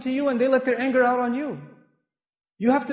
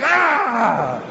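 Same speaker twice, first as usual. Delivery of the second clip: mock sharp retort; 2 semitones higher, 6 dB louder than usual. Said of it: "They'll be like".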